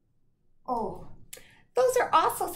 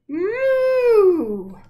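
loud cow moo; 9 semitones higher, 12 dB louder than usual. Acted. Cows mooing in story.

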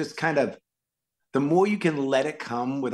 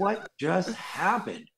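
slow, higher, half-laughing disbelief; 6 semitones higher, much slower than usual. Retold or imitated. Actor retelling the ride home to hosts, slow laughing disbelief. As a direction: laugh at how absurd it was. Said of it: "I was like".

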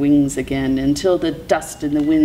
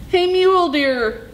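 high-pitched call to someone off; 13 semitones higher than usual. A narrator's reading. Coyote hails Mule Deer for help, calling out. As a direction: call out high, trying to get attention. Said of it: "Coyote called out".

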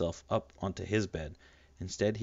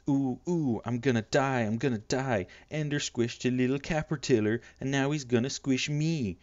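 1 mock fear; 5 semitones higher than usual. Peter mocks Ender's threat.

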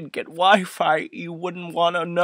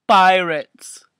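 ordinary talk

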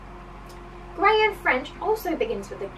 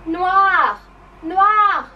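high, booming French word; loud, drawn-out classroom shout; super loud; 5 semitones higher, 9 dB louder than usual. Shown as a demonstration. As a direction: call it out across the room. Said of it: "Super loud".

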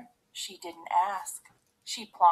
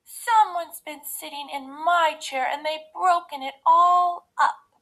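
high, loud wail, rising to the end; Baby Bear; 7 semitones higher, 7 dB louder than usual. A narrator's reading. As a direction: wail as the upset little cub. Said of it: "cried Baby Bear".

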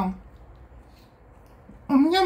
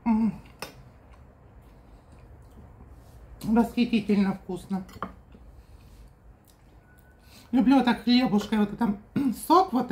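talking between bites; muffled chewing speech; mouth full, chewing. Real, not acted. Seen 0: Took spoonful at -1; closed, moving jaw through labelled words.

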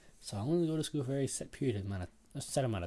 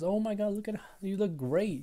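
gushing compliment; 11 semitones higher, 4 dB louder than usual. Retold or imitated. Someone complimenting a friend's looks, high and gushing. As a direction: gush it well above your usual pitch.

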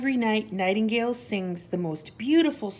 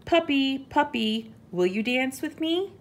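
high, eager mouse invitation; little mouse calling; 8 semitones higher, 5 dB louder than usual. A narrator's reading. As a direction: call the animal, then lift into the question. Mouse calls to an animal.

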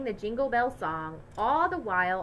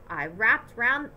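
ordinary delivery, nothing marked

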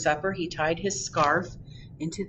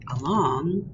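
usual speaking voice

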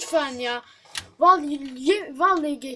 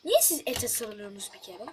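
ordinary delivery

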